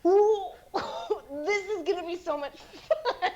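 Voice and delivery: giggling through a remembered thrill; gleeful giggly recollection; excited laughing quote. Retold or imitated. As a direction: laugh it out, delighted. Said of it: "a first moment for me where I was like".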